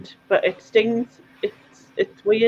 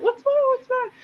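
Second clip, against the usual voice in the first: worried dad; 4 dB quieter than usual. Retold or imitated. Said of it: "he was like".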